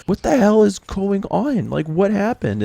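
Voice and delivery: high, slow, stunned mock shout; shocked outcry. Walks into pepper smoke coughing.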